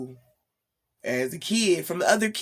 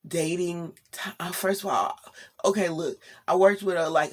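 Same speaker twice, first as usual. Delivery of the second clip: normal talking voice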